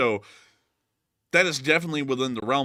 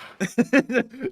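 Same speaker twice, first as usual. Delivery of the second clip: high laugh; 11 semitones higher, 4 dB louder than usual. Real, not acted. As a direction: burst of high-pitched laughter. Laughs at the word.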